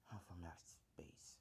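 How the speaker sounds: soft, whispery dark alien voice; hushed creature growl; Venom. Acted. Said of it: "just think of a Venom voice".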